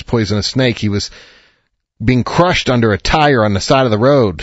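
usual speaking voice

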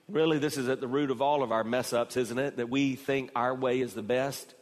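normal talking voice

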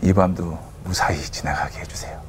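low, whispered retold prayer; soft, halting plea to God; hushed prayer. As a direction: pray under your breath so nobody wakes. Retold kneeling prayer in sleeping corridor.

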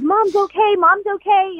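imitating husband's joyful frantic call; frantic happy yelling. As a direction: echo his frantic relief to the kids. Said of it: "yelling, frantic, happy, crying".

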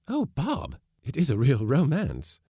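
put-on high, bright-eyed young wife; a high, eager young woman; Mary, delighted. A narrator's reading. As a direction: lift into her voice, thrilled.

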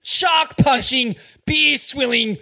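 slow, swelling to a loud yell on lunatic; building to a shout. Real, not acted. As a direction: pile it up and explode on lunatic. Building hype list.

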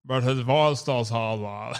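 slow mock drawl, leaning on the R in "hard R"; exaggerated hard R. Shown as a demonstration. Host mocking the actor's accent, "hard R" dragged out heavily. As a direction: drag out "hard R" with a heavy American R. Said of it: "he's doing hard R's or whatever".

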